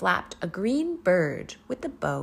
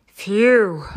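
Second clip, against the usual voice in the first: loud sigh of relief; loud relieved phew; 3 semitones higher, 12 dB louder than usual. Acted. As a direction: blow out in relief. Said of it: "said the dog".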